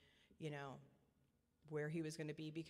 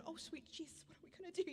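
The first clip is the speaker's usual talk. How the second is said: much higher, panicked inner voice; frantic, pitched-up inner cry of a scared mother; 13 semitones higher than usual. Retold or imitated. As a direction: let the panic climb up high in your head. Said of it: "And on the inside, I'm going".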